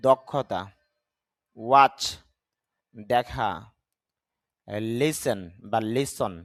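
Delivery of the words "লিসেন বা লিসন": লিসেন set against লিসন with a silent t; slow contrast of লিসেন and লিসন; correct pronunciation. Shown as a demonstration. Teacher slowly contrasts লিসেন with the correct লিসন. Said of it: "যদি আমরা লিসেন বলি, তবে শুদ্ধ উচ্চারণটা হবে লিসন".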